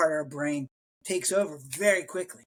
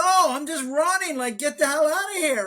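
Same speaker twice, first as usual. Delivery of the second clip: mock panic; 9 semitones higher, 4 dB louder than usual. Panicked flight from a lion.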